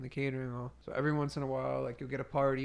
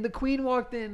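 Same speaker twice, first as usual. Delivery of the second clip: higher and louder, sarcastic; mock royal announcement; 10 semitones higher, 4 dB louder, slower than usual. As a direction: announce her like a queen to mock her. Mocking the entitled customer.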